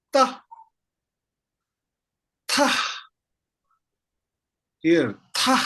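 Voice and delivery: Ta said high and short, then repeated; syllable drill. Shown as a demonstration. Teacher to class, modelling the sound. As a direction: model Ta crisply for students. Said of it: "I will read them one by one".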